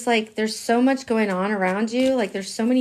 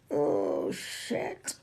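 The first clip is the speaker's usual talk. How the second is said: lower, softer mock "oh shit"; mock dismayed mutter; 6 semitones lower, 9 dB quieter than usual. Imitating Gemini in a pickle, low mock mutter. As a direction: mutter it like you just got caught. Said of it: "now you're like".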